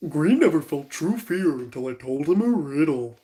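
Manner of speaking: a light attempt at a dopey cartoon dog; lightly voiced Goofy, not quite in range. Shown as a demonstration. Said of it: "in a goofy voice".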